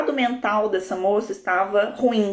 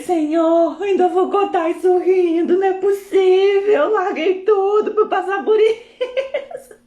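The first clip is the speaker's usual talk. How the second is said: higher, laughing half-sob to the Lord, slowing at the end; a retold desperate prayer, hammed up; tearful plea to God; 10 semitones higher, 4 dB louder, slower than usual. Crying in the shower, begging God, mock tearful plea. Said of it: "eu chorando assim".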